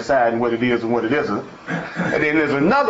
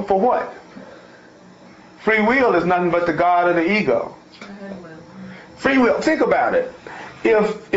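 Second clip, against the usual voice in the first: higher, pressing sermon voice; fired-up preaching; 4 semitones higher than usual. Acted. Preacher driving the point home to the congregation, fervent. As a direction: preach it with rising fire. Preaches about free will.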